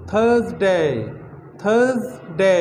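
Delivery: थर्सडे with a z sound before डे; careful model word; z sound in the middle. Shown as a demonstration. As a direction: say थर्सडे with a clear z. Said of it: "जे की आवाज़ आएगी दरमियान में".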